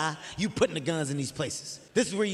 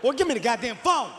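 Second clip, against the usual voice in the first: louder, higher exasperated dad; mock angry demand; 5 semitones higher, 5 dB louder than usual. Dad demanding his son's phone, mock angry. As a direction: snap out a mock-stern order.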